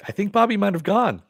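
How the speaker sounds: high, loud, just after laughing; amused. Real, not acted. Host tickled by the retold joke, amused. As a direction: speak up high on the tail of a laugh.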